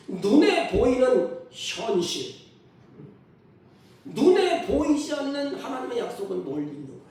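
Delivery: high, loud, drawn-out pulpit proclamation; forceful preaching. Real, not acted. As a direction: preach it out slowly at full voice.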